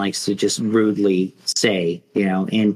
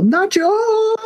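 very high, drawn-out yell; triumphant-move yell. Acted. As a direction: yell the name sky-high and hold the last vowel. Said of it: "and yell".

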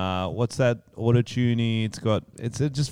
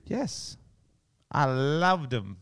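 slow, deadpan sarcastic yes; 2 semitones higher, 7 dB quieter, much slower than usual. Real, not acted. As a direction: say it like you mean the opposite. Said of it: "That sounds very sarcastic".

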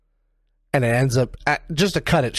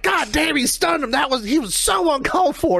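high-pitched mock JR yelp, much higher; imitating JR; 11 semitones higher than usual. Podcaster imitating an announcer's shock, high mock yelp. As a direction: squeal it like an outraged commentator. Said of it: "'Cause he was like".

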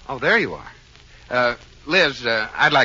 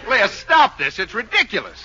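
higher, louder protest; exasperated; 8 semitones higher, 3 dB louder than usual. Acted. Husband tells wife to stop it, exasperated. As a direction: order her to stop, voice raised.

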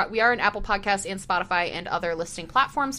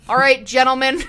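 loud teacher call, rising; loud sharp voice; 5 semitones higher, 10 dB louder than usual. Retold or imitated. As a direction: shout it like a teacher to the class. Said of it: "my teacher voice is".

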